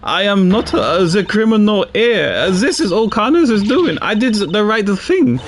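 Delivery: lightly voiced nervous French suspect; flustered accused woman. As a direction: deny it, rattled and cornered. Voicing accused woman, French accent.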